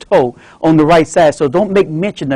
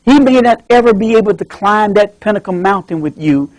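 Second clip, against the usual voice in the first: ordinary talk, nothing marked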